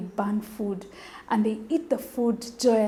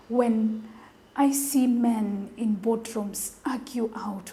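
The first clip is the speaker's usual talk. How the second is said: normal talking voice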